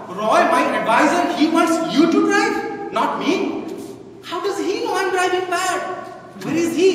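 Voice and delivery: high, loud acted disbelief; indignant disbelief. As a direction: protest in mock astonishment, pitch climbing. Driver acts out offended disbelief.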